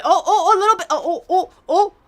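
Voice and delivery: high, loud, laughing oh-oh-oh; giddy laughing shriek; excited laughing squeal. Real, not acted. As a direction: squeal with delight at the tiny movement. Reacting to movement, laughing.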